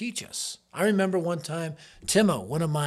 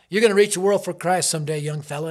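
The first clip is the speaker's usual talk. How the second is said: loud, quick and higher, tossed off in passing; preacher calling out; 4 semitones higher, 10 dB louder, faster than usual. Retold or imitated. Preacher to a young student in the hallway, calling out. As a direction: imitate a preacher's loud, hurried encouragement. Preacher calls out while striding past.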